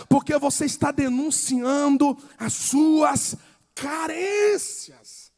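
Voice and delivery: loud, driving sermon delivery; preaching at full force. Acted. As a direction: hammer the point home to the congregation. (pregação inflamada, em voz alta)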